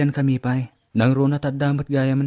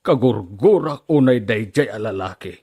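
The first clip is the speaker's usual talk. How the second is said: angry father; 4 dB louder than usual. Acted.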